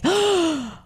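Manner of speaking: high, breathless starstruck news; gasping to share a celebrity sighting; wife bursting with celebrity news; excited wife. Retold or imitated. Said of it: "she's like".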